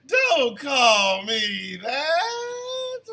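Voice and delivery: playful outraged shout at the chat; protesting. Real, not acted. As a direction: stretch it out, don't call me that. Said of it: "D T over in the TikTok chats".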